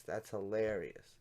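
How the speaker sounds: normal talking voice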